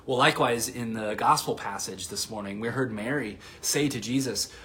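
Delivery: ordinary talk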